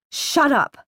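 said tightly. A narrator's reading. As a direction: cut the daughter off tightly, pitch up. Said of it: "Mayra said tightly".

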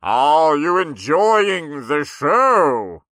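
slow, booming ringmaster call; loud megaphone announcer. A narrator's reading. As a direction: stretch it out big for the whole tent. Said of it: "asked in a loud voice through the megaphone".

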